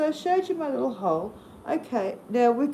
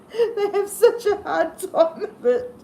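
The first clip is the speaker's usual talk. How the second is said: slow, higher, giggling English lady; laughing through the quote; 8 semitones higher than usual. Retold or imitated. Retelling a Monty Python line to viewers, laughing. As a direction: quote it while cracking up. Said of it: "the way she says".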